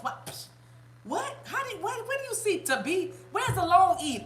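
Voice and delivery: high-pitched, mock-baffled questioning; mock incredulity. Mother imitating her reaction to son's mispronunciation, mock incredulous. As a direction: act baffled, pitch shooting up.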